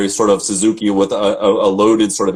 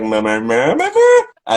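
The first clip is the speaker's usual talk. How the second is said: hammed-up kiddie tantrum noises; dramatic child; slower than usual. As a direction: mimic a child's fussing, climbing to a squeak. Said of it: "when she gets dramatic like that".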